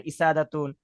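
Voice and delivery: mispronounced ইসাদাতুন without its w; wrong initial vowel. Shown as a demonstration. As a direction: say ইসাদাতুন starting on "i", dropping the w. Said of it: "আবার এরকম যেন না হয়".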